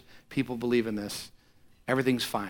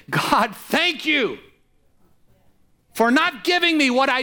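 preached thanksgiving prayer; 8 semitones higher, 5 dB louder than usual. Acted. Preacher voicing a tither's grateful prayer at full force. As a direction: lift it up like a pulpit prayer of thanks. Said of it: "this is what we're saying".

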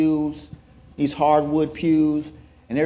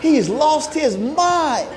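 mocking brothers; 9 semitones higher, much slower than usual. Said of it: "they're looking at me like".

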